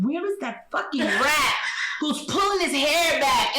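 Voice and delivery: slow, drawn-out exasperated question; stressed question. Retold or imitated. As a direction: stretch it out, frazzled. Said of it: "I said".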